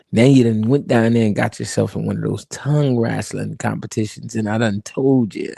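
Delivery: low, soft, unhurried elder; calm old boss scolding. Retold or imitated.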